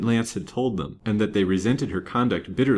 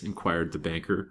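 ordinary delivery

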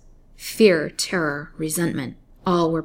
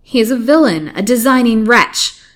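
loud, higher indignant outburst; 5 semitones higher, 10 dB louder than usual. A narrator's reading. Captive girl denounces her captor to the doctor, indignant. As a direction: break out in indignation, louder and higher. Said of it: "she broke out indignantly".

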